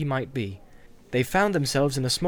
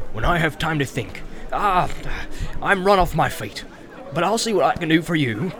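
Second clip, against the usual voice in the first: bustling innkeeper. Acted. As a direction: greet the guest heartily. Landlord chattering on.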